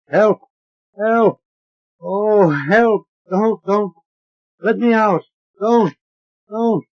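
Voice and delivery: high, loud frantic screams; a trapped voice screaming to be let out; panicked screaming. A narrator's reading. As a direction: shriek and plead in terror. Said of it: "the voice screams".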